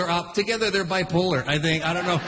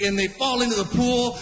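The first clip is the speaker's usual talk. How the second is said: ordinary talk, nothing marked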